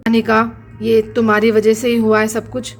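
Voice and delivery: a deep-voiced husband laying the blame; stern husband. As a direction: blame her in a deep, hard man's voice. Husband figure blames wife.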